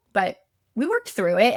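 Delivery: normal talking voice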